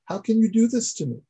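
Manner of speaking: amazed accusation. Retold or imitated. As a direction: demand an answer in disbelief. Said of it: "Yaakov says in amazement".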